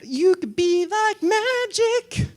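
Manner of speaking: high, slow, magical lilt; sing-song voice. Pretend. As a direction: croon it like a spell. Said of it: "you have to do it in a little sing-song voice".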